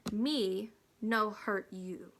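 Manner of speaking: slow, deliberate broken English; spoken haltingly like a film Indian; a slow, clipped Me no hurt you. Acted. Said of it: "supposed to be in Indian English".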